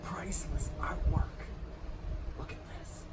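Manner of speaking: very soft, whispered aside; barely audible whisper; hushed whisper. Real, not acted. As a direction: whisper it secretively. Hushed aside while exploring, posing.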